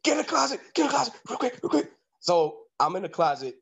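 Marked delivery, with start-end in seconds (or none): 0.0-1.9 high-pitched panicked woman, hushed urgency; high, urgent mock plea. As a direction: imitate her frantic order to hide. Woman hurriedly hides lover.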